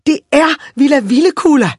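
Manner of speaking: put-on loud, high girl's announcement; triumphant shout. A narrator's reading. Red-haired girl answering the fine gentleman, shouting it out. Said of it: "råbte hun".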